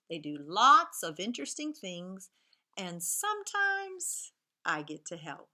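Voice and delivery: clear, bouncy storybook reading; reading to children. Acted. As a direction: read the story to a class of young kids. Teacher reads picture book aloud.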